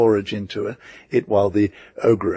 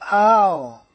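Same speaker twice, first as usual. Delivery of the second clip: pained yelp; 10 semitones higher, 4 dB louder than usual. Acted. Master cries out in pain.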